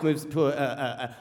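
ordinary talk, nothing marked

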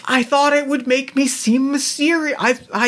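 high-pitched defensive protest; wounded self-justifying, high-voiced. Acted defensive reply in bickering.

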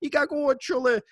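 loud high street-hawker cry in Italian dialect; Italian street vendor yelling his wares; vendor screaming. Retold or imitated. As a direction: belt it out like a fruit seller from his truck. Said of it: "screaming at the top of their lungs".

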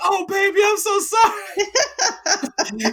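very high drawn-out mock mother wail; imitating mom. Mom pitying her lonely son, very high wail.

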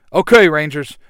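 loud team leader's call; rallying yell. A narrator's reading. Jason sending the Rangers back out, loud rallying yell. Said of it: "Jason yells".

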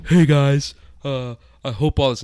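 loud, high goofy stoner dude. Acted. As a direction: read it like a spaced-out stoner. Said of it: "I'll do it in the, the stoner voice".

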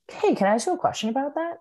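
lightly acted, high-pitched careful questioning; gentle probing. Support-group leader questioning a mother's choice, gentle probing. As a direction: raise the pitch into a tactful, curious query.

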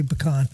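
pecan said as puh-KAHN; impressive pronunciation. Shown as a demonstration. Co-host showing how he impresses people, pecan as puh-KAHN. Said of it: "Unless I wanna be impressive".

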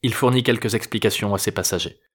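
usual speaking voice

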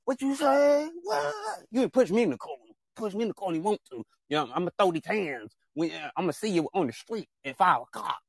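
mock tough guy. Acting out a confrontation quote.

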